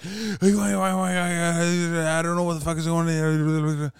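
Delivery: high, babbling stammer; panicked stuttering. Retold or imitated. Terrified friends telling the dad, high frantic stutter. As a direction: trip over the words in mock panic. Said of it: "They're stuttering".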